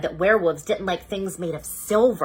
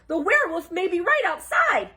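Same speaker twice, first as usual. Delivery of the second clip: high, stressing right; frightened Shaggy; 11 semitones higher than usual. Acted. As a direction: warn them in fright. Shaggy warning.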